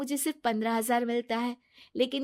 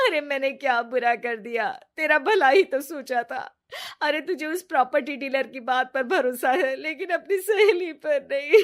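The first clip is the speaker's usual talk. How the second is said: louder wailing sobs, put on to win sympathy; loud fake crying; 4 dB louder than usual. Friend caught lying bursts into loud fake crying. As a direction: blubber theatrically through the words. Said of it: "जोर-जोर से रोने लगती है".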